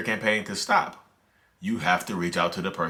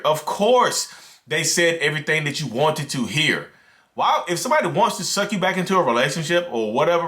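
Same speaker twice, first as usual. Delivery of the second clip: passionate rant; 6 semitones higher than usual. Real, not acted. Creator ranting to viewers about narcissists, heated. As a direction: let it all pour out, worked up. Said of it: "You hear my voice change?".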